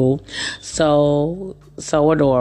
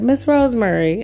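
colleague calling; 8 semitones higher than usual. Retold or imitated. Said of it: "she said".

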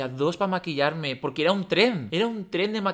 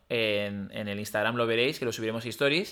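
normal talking voice

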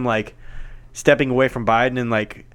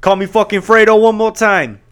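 louder, higher hammed-up fury; mock angry threat; 5 semitones higher, 4 dB louder than usual. Retelling the actor's angry threat over a nickname, hammed-up. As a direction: bark it like an offended tough guy. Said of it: "But he's like".

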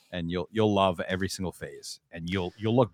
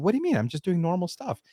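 much higher mock-innocent protest; playing innocent; 9 semitones higher than usual. He retells his own innocent reply to his wife, much higher. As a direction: act falsely innocent. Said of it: "I said".

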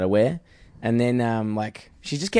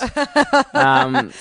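usual speaking voice

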